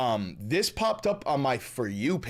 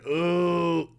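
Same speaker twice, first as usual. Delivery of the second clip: loud, higher drawn-out hoot; imitated owl hoot; 5 semitones higher, 5 dB louder than usual. Mimicking the owl hoot again.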